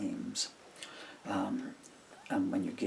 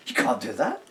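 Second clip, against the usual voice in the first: retold objection; 4 semitones higher, 10 dB louder than usual.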